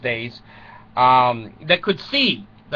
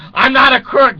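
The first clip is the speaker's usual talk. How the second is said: loud, high denial; mock Nixon; 8 semitones higher, 7 dB louder than usual. Nixon on the plane, a loud mock denial. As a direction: deny it like a cornered president.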